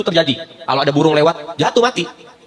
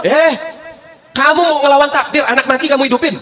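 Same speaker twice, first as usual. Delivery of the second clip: higher, indignant challenge; challenging saint; 7 semitones higher than usual. Acted. Saint challenges the maid for reviving his child, indignant.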